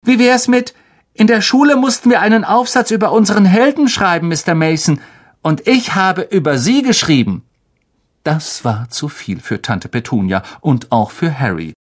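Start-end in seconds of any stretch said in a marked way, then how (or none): 0.0-7.4 high put-on boy voice, rattling off; flattering little son. A narrator's reading. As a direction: recite syrupy flattery in a high, quick voice.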